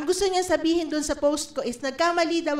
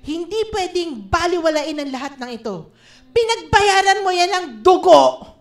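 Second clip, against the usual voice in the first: louder, a declaring prayer that claims what is owed; bold claim prayer; 7 dB louder than usual. Shown as a demonstration. Preacher leading the church in a loud prayer of claiming. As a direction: pray it like you are taking back what is yours. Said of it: "ang sinasabi mo dapat".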